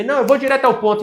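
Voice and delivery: high, loud and fast, cutting the talk short; a client who wants the point now; impatient client. Retold or imitated. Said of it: "se eu perceber".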